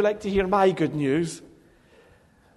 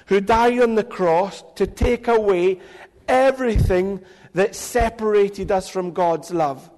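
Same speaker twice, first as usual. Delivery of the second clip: usual speaking voice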